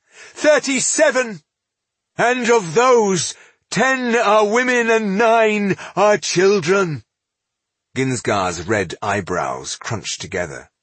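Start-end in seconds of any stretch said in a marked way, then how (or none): 0.1-7.0 high, loud, slow outcry; anguished shout. A narrator's reading. Elf counts his surviving people at dwarf, shouting. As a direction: hammer out each count, voice raised high. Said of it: "shouted Estelin".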